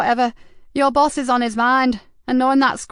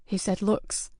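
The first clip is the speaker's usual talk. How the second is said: hushed, much softer, almost breathless; breaking the worst news gently; whisper; 6 dB quieter, slower than usual. Acted. Nicky quietly confirms the threat to Joseph, hushed. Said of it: "Nicky's voice was a whisper".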